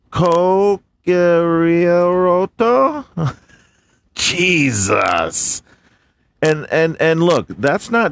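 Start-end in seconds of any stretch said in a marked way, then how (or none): 0.1-3.0 slow sounding out. Shown as a demonstration. Sounding out a hard name.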